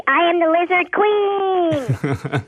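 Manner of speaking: put-on slow, high little girl voice; Lisa Simpson voice. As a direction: say it like a dramatic cartoon girl. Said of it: "it's probably".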